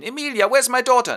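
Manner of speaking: high, loud frantic call; mother searching for her daughter. Acted. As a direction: burst in demanding your child.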